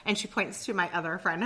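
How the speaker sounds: ordinary delivery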